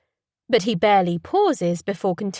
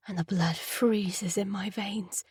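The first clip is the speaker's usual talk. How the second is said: ordinary delivery, nothing marked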